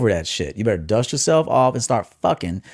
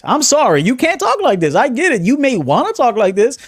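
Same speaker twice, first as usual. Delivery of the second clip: high-pitched protest; 11 semitones higher, 3 dB louder than usual. Real, not acted. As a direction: jump into a high, strained falsetto. Repeats the point in falsetto.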